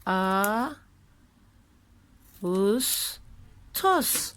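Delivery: "A, us, tos" split into syllables with pauses; sounding out words. Shown as a demonstration. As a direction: sound out "A", "us", "tos" one at a time. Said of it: "Ağustos'u heceleyelim sizinle".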